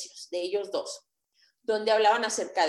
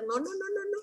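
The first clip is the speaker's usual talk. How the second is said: high squeaky no, no, no, no, much higher and softer; mock horrified denial; 9 semitones higher, 5 dB quieter than usual. A vlogger watching her first video, squealing in mock embarrassment. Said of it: "y decía".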